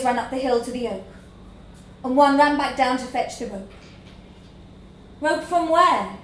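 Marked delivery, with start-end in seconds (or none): 5.2-6.2 sullen. A narrator's reading. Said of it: "said sullenly".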